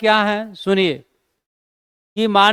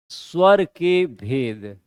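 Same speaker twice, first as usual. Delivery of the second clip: slow dictation; 6 semitones lower, slower than usual. Shown as a demonstration. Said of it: "अगर ऐसे question कर दे".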